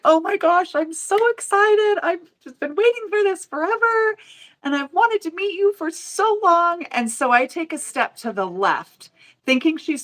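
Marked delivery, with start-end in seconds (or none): 0.0-6.8 high, squealing gushing fan. Retold or imitated. Fan running up to the demo table, gushing high and excited. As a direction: gush like a starstruck fan. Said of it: "she's like".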